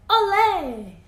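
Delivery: loud, high festive shout; cheer. Acted. Rooster celebrating the salsa to the barnyard, loud high cheer. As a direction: whoop it out like a proud rooster.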